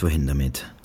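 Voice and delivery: plain reading voice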